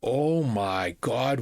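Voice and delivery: normal talking voice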